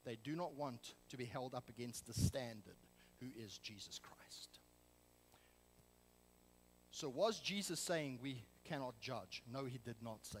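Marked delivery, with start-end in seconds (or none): 3.2-4.6 hushed, breathy whisper of conviction; a dramatic preacher's whisper. Real, not acted. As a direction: drop to a whisper to make them lean in. Sermon climax, dropped to whisper.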